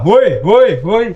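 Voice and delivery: loud echoing call, high and loud. Real, not acted. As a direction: shout into the tunnel to hear the echo. Suara pun akan menggema.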